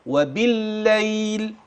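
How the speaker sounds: وبالليل drawn out, doubled lam held thin; slow demo of the thin lam. Shown as a demonstration. Teacher showing the doubled lam, slow recitation. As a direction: stretch وبالليل slowly, keep the lam light. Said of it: "برضو مرققة".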